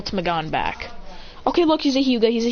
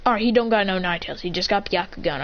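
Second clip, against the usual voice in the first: plain reading voice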